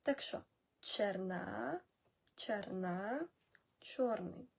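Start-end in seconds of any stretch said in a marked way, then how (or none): 0.8-3.3 slow Czech черна, teacher modelling. Shown as a demonstration. Teacher models the Czech word черна slowly for learners. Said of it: "я буду чеською це казати".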